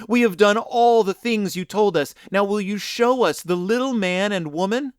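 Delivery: put-on children begging excitedly; eager plea. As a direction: beg eagerly to see it. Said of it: "they cried".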